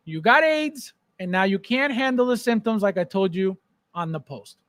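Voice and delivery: usual speaking voice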